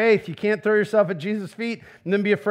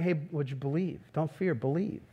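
much softer, lower and tender; gentle Jesus voice; 3 semitones lower, 11 dB quieter than usual. Retold or imitated. Jesus gently reassures a troubled believer, soft and tender. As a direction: coax it softly like a caring friend. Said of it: "can you imagine Jesus going".

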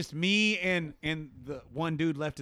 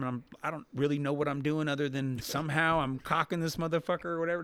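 normal talking voice

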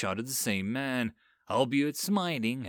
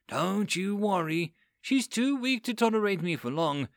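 slurred; 6 semitones higher than usual. Acted. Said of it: "Her voice slurred as she spoke".

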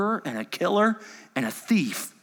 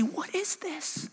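much higher amazed onlookers; astonished crowd. Acted. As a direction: gasp it out like a stunned bystander. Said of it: "And they say".

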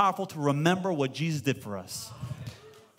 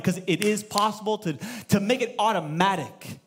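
usual speaking voice

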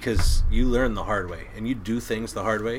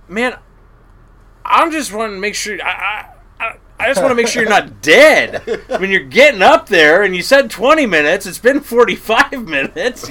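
loud protesting retort; 11 semitones higher, 14 dB louder than usual. Retold or imitated. Defending himself to the angry captain.

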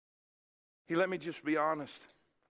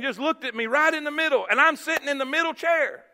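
raised, high preaching voice; animated preaching; 7 semitones higher, 7 dB louder than usual. Acted. Preacher telling the church his testimony, animated preaching. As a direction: drive the testimony along with energy.

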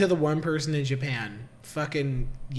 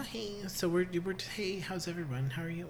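very soft, intimate hello; hushed greeting; 3 semitones higher, 12 dB quieter, faster than usual. Pretend. Greeting listeners after breathless teasing, hushed.

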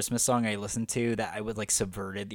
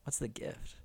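whisper; 11 dB quieter than usual. Retold or imitated. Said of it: "Shh, she got a gift".

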